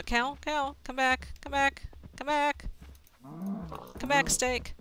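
loud pleading call; calling after. Real, not acted. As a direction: shout after the runaway cows. Chasing fleeing cows.

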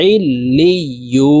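slow ইলিয়ু with the final u drawn out; stretched vowel. Shown as a demonstration. Teacher showing the one-alif pull, stretched vowel. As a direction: hold the long u of ইলিয়ু steadily. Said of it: "এক আলিফ টেনে পড়বো".

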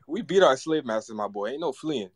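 normal talking voice

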